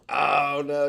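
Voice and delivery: loud, high mock "oh no"; mock alarm. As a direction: play up the shock at the forgotten balsamic. Reading a chat message aloud.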